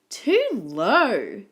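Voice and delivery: a mild storybook scream; mock scream. A narrator's reading. Goldilocks trying Mummy Koala's bed, mildly screamed complaint. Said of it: "she screamed".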